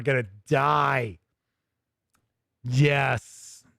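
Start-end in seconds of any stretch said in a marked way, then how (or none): none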